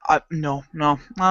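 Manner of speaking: ordinary talk, nothing marked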